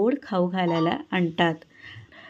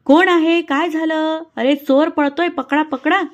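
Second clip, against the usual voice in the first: higher, louder alarmed shouting; villagers calling out; 7 semitones higher, 5 dB louder than usual. A narrator's reading. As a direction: call out across the village. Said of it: "गावकरी म्हणतात".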